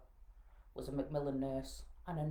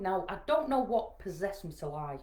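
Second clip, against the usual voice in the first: ordinary delivery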